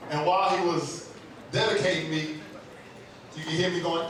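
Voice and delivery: ordinary delivery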